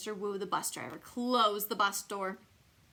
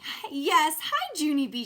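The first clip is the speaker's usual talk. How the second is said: louder bright friendly hi; friendly greeting; 6 semitones higher, 7 dB louder than usual. A narrator's reading. Grace greeting Junie B from her bus seat, chirpy friendly hello. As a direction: wave hello cheerfully. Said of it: "Grace waved at me real friendly".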